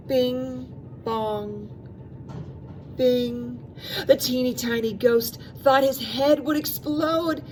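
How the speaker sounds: plain reading voice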